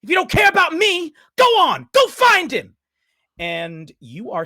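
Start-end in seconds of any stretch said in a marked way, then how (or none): 0.0-2.8 very loud, high, all-out yell; screamed, bitter outburst; furious shout. Acted. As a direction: shout it across the street in fury. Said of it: "you hear a voice shout out".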